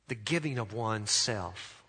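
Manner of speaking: usual speaking voice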